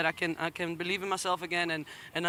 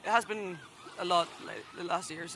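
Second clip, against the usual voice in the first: emotional; 7 dB quieter than usual. Real, not acted. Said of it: "I'm sorry that I'm really emotional".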